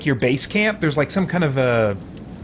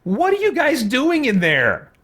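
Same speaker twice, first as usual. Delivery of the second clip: high-pitched, incredulous question; baffled and put out; exasperated disbelief; 8 semitones higher, slower than usual. Real, not acted. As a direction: demand an explanation, pitched way up. Player baffled by his party.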